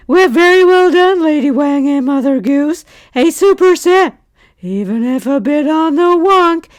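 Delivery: high, loud put-on voice; put-on character reading. Host reading out a viewer's congratulations, high put-on character voice.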